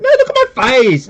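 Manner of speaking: loud, high-pitched outburst; mock insistent cry. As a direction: shout it in hammed-up distress. Acted insistence in retold scene.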